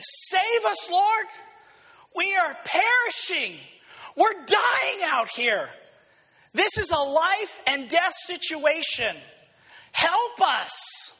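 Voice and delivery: disciples crying out high and pleading; desperate plea. Acted. Disciples in the storm begging Jesus, panicked high plea. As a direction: beg the Lord to save them from drowning. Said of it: "They said".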